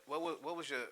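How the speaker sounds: ordinary talk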